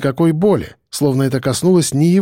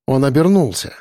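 ordinary delivery

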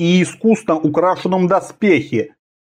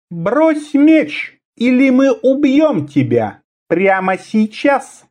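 high, slow, cold assassin voice; high ringing voice; 7 semitones higher, slower than usual. Acted. Assassin's voice held.

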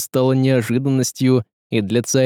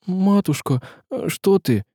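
startled cry; 8 semitones higher than usual. A narrator's reading. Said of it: "только и вскрикнул Мудрик".

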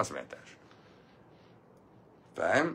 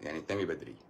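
quiet repeat; 7 semitones lower, 13 dB quieter, faster than usual. Shown as a demonstration. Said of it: "repeats the advice".

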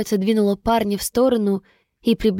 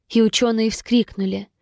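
ordinary delivery, nothing marked

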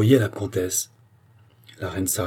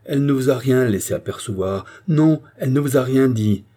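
loud, higher, emphatic denial; crying out; 5 semitones higher, 6 dB louder than usual. A narrator's reading. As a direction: hammer out each denial. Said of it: "s'écria-t-elle".

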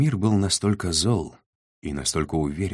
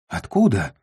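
higher, louder startled yelp; squealed question; 4 semitones higher, 5 dB louder than usual. A narrator's reading. Trader jumping at the van's strange cargo, squealing. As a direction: squeal it out in alarm. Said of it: "взвизгнул Майно".

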